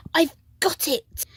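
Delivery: high, bright eureka; excited cry. A narrator's reading. Snail realizing how to help, high excited cry. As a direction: burst out with the idea. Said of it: "she cried".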